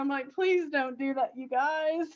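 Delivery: pleading through real laughter; giggly plea, losing composure; laughing hard. Real, not acted. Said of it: "I'm laughing so hard".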